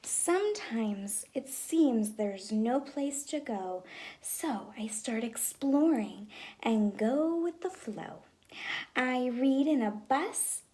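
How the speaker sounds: put-on lively storybook read-aloud for kids; reading to children. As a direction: read it like story time in class. Teacher reads picture book aloud.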